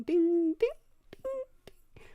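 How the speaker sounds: high sung ding ding like a computer chime; startup chime imitation. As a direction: sing the Windows startup jingle high. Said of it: "introduction music on".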